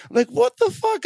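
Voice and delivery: mock outrage. Founder retelling his reaction to being called intimidating, mock outraged squeak. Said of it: "outraged by that".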